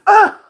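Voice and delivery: a high, loud mock wail of pain; mock anguished scream. Vlogger mocking Hollywood's reaction to the free apps, high loud mock scream. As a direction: cry out like a wounded studio. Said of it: "That Hollywood's just going".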